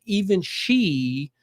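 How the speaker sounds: ordinary talk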